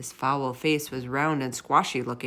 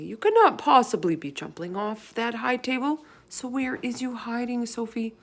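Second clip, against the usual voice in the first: desperate thought; 6 semitones higher than usual. A narrator's reading. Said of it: "he thought desperately".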